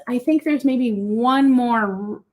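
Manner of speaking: usual speaking voice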